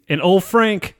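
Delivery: usual speaking voice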